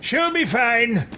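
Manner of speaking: high, slow, dismissive villain; hammy villain voice. Pretend. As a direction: brush it off in a drawn-out cartoon villain voice. Voicing character's flippant reply.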